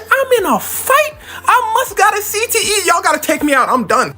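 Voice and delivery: high, squeaky comic voice of a dazed fighter; panicked beaten boxer. Acted. Rodriguez panicking to his corner, in a high squeaky voice. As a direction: squeal it like a fighter who just learned he's fighting.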